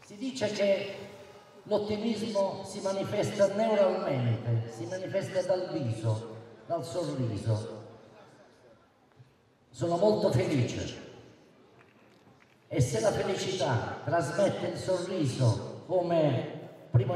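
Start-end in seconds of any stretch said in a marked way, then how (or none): none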